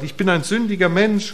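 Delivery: ordinary talk, nothing marked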